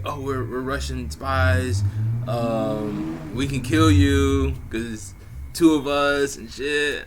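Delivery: lightly voiced playful threat, slow; imitating the Russian girls. Russian girls joking they're spies.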